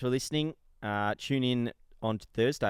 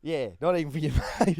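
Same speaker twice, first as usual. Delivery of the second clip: laughing; 6 semitones higher, 8 dB louder than usual. Real, not acted. Teasing co-host, laughing.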